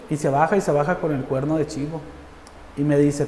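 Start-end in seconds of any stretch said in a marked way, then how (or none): none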